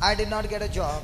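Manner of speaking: mildly let-down, falling delivery; mock material sorrow. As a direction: feign a little disappointment. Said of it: "Material sorrow". (லேசான போலி ஏமாற்றத்துடன்)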